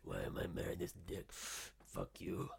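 put-on rough whispered Boston accent. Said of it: "that's how she sounds".

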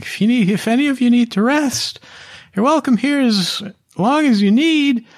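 very high, squeaky little halfling voice; halfling Amelia. Acted. GM voicing the halfling urging the party inside, high and squeaky. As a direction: squeak it out in a tiny urgent voice. Amelia offers them rest.

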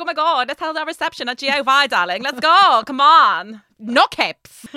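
loud, high, hammed-up camp exclamations. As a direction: gush flamboyantly at full volume. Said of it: "he got camper".

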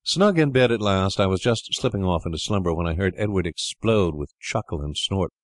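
ordinary delivery

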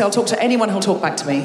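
ordinary talk, nothing marked

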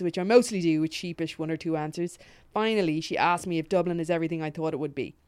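usual speaking voice

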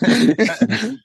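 high startled oh; surprised gasp. Retold or imitated. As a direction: squeak of surprise. Surprised at Roger Moore.

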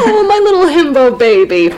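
put-on high, laughing baby-talk coo; mock cooing. Host fussing over silly Banquo, laughing coo. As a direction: coo fondly through a laugh.

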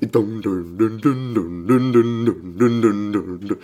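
low, soft rhythmic "dun" vocalizing; chugging "dun dun" riff imitation; imitated song riff. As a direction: mimic the opening groove with your mouth. Imitating the drummer starting the song.

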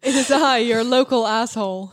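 loud, hammy announcement of oneself; mock villain entrance. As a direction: boom it like a pantomime baddie. Mock grand self-introduction.

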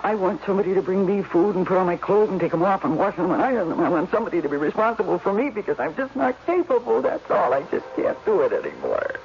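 ordinary talk, nothing marked

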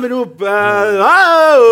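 mock-kid explaining the chant; playful shout; childish reply. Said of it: "Så sier han".

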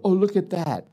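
voicing the wife, high and delighted. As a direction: point out the find with a lifted, charmed oh. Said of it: "and she'll go".

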